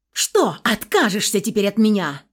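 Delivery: loud, confrontational outburst; angry challenge. A narrator's reading. Family quarrel over being disowned, loud angry challenge. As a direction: throw the question out loudly, as a challenge.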